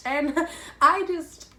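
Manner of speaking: embarrassed laughter cutting off the words; laughing. Real, not acted. To her viewers about freezing, laughing. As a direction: laugh it off, trailing away.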